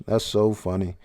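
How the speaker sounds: ordinary talk, nothing marked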